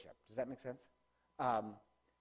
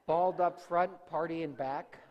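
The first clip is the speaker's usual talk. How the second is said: loud, higher punchline; excited joke; 5 semitones higher, 10 dB louder than usual. Real, not acted. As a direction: belt out the mullet gag. Joke about mullet haircut.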